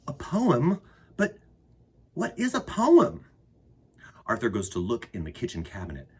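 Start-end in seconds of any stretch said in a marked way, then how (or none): 0.1-3.2 put-on puzzled child. Arthur puzzled, high voice.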